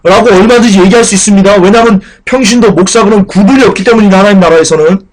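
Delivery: high, loud pulpit fervor; impassioned preaching. Acted. Pastor urging the congregation, fervent preaching. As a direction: preach it hard to the pews.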